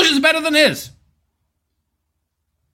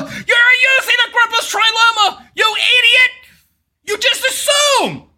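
high, heckling yell; derisive shouting; 8 semitones higher than usual. Real, not acted. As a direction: shout it accusingly at an opponent. Heckling and insulting the debater.

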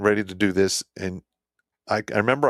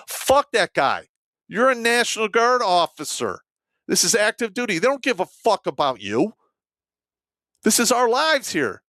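indignant outburst; 10 semitones higher than usual. Retold or imitated. Soldier arguing with company commander over lives at stake, indignant outburst. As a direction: plead hotly, as if lives depend on it.

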